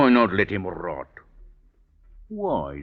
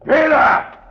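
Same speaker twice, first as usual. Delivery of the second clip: calling out; 11 dB louder than usual. Acted. Captain shouts for the jailer, loud call. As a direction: shout for the guard, high and loud.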